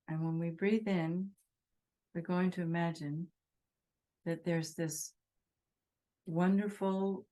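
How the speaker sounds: unhurried phrases with long silences; guided meditation. Real, not acted. Teacher asking meditators to think of all beings, guided meditation. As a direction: guide them gently, leaving space between phrases.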